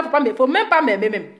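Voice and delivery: ordinary talk, nothing marked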